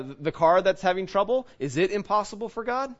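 ordinary talk

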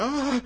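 sudden high startled cry at a car; scared yelp. Retold or imitated. Boy on a bike startled by a pulling-out car, high yelp. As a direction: jump and yelp in fright. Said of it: "I'd get all scared".

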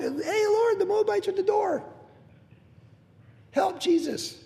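high, pleading cry to the Lord; mock panicked plea. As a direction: call for help like a frightened believer. Mock panicked prayer at the door.

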